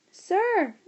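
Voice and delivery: a high, loud yell of sir. A narrator's reading. Boy grabbing a teacher, yelling sir. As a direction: yell to grab the teacher's attention. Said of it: "he shouted".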